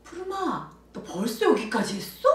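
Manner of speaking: high, loud, put-on amazement; pretend surprise. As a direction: act amazed at how far the child got. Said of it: "놀래주는 척을 해요". (놀란 척 감탄하는 말투)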